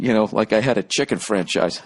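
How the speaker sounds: ordinary delivery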